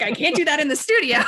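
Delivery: giggling mock protest; laughing quote. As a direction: laugh as you admit it. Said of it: "And I'm like".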